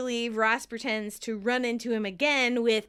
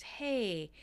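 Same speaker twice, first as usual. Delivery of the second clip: soft, careful checking-in; gently coax, rising at the end; very calm; 5 semitones higher, 4 dB quieter, slower than usual. Acted. Monica to Phoebe still on hold, soft overly calm voice. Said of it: "she's speaking very calmly".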